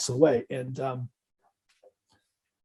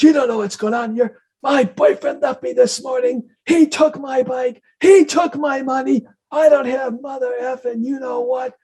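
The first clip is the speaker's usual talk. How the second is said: much higher, imitated woman's wailing rant; retold hysterical screaming; 9 semitones higher than usual. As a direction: mimic her desperate, furious outburst. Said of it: "she's screaming at me".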